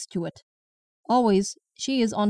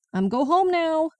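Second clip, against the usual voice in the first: high sing-song threat; 7 semitones higher than usual. Acted. Grandma threatening to leave.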